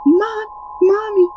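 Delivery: put-on high, slow little-girl voice; imitating a small child. Daughter waking mom at dawn, high, slow little-girl voice. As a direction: mimic the daughter's whiny complaint. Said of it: "and she's like".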